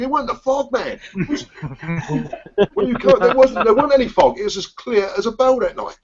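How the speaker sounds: high-pitched, incredulous mock outrage; indignant retelling. Host recalls the driver who wrecked his car: high mock outrage. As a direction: replay your disbelief, pitching right up.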